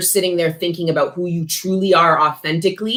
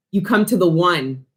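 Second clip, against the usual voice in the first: usual speaking voice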